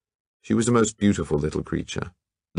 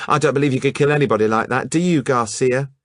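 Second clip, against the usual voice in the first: fast, loud, higher scoff; 7 semitones higher, 4 dB louder, faster than usual. Acted. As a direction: dismiss it with scorn.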